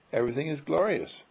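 ordinary delivery

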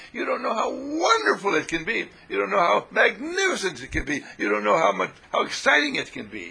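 loud, high, fervent urging; passionate outcry. Real, not acted. Teacher urging the audience, loud and fervent. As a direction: pour out the wonder at full force.

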